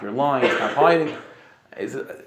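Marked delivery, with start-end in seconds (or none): 0.0-1.2 louder, slower, pressing him hard; insistent accusing. Retold or imitated. Townspeople press the stranger to confess, slow insistent demand. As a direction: drag the truth out of him, slow and firm.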